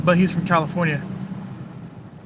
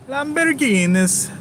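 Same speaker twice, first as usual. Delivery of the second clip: much higher, slow, relishing "Lamborghinis"; savoring the name; 7 semitones higher, slower than usual. Real, not acted. Admiring parked supercars, slow high savoring. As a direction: roll the word out like it tastes good.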